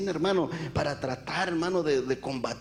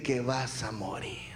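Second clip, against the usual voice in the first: unvoiced slow whisper of doom; 4 semitones lower, 6 dB quieter, slower than usual. Doctor whispering his verdict to the sick man. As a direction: breathe the death sentence softly and slowly.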